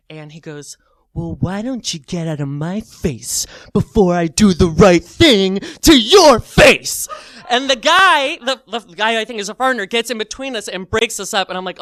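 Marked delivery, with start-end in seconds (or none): 1.1-7.1 loud menace building to a shout; pissed-off mock threat. Man threatening the teller, imitated anger rising to shout. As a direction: build slowly to a hammed-up bellow. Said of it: "he starts to get pissed off".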